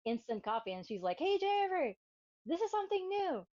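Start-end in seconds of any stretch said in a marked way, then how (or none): 1.2-3.5 higher, louder eager voice; eager friend. Retold or imitated. Laura presenting instant coffee to Jennifer, eager higher voice. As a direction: hand over the gift with excitement.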